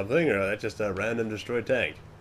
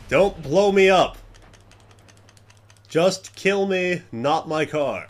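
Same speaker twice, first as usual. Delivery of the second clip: frantic pleading; 6 semitones higher, 5 dB louder, much slower than usual. Real, not acted. Pleading with attacker over car.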